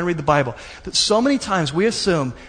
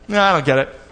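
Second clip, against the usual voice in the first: giving up on the manual; 3 semitones lower than usual. Shown as a demonstration. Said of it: "you know how I read manuals? Like this".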